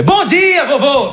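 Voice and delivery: high, excited call; exuberant greeting. Retold or imitated. Grandson surprising his grandfather in Portuguese, exuberant greeting. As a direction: burst out with a delighted high-pitched hello.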